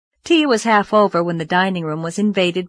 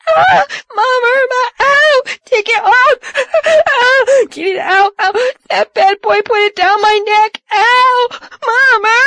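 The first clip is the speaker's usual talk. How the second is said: put-on shrieking, crying child; high panicked wailing; hysterical shrieking; 8 dB louder than usual. A narrator's reading. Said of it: "the hysterical, writhing Loretta".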